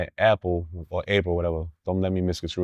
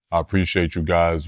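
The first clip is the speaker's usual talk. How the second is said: imitating a deep-voiced man, pitch dropped; low-pitched impression of a deep-voiced star; 4 semitones lower than usual. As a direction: drop low for a mock deep voice. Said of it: "he was talking with a deep voice".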